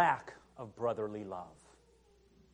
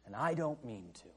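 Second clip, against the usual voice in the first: soft vow; 3 semitones lower, 9 dB quieter than usual. Retold or imitated. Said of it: "looks at him and says".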